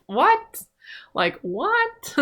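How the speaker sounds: a mock-baffled exclamation; incredulous what. Real, not acted. Baffled by dating decisions.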